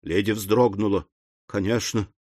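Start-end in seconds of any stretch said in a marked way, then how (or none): 1.5-2.1 high, coy lady's voice; maidenly embarrassment; bashful. A narrator's reading. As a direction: say it shyly, voice climbing. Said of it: "стыдливо сказала она".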